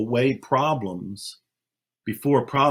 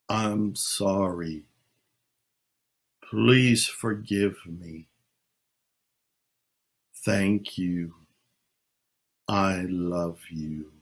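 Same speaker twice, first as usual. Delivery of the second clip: slow, softer heartfelt plea; slow sincere prayer, dropping to a hush at the end; 4 semitones lower, 4 dB quieter, much slower than usual. Shown as a demonstration. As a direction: speak as if truly heard by one who loves you. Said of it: "really imagine you are being heard".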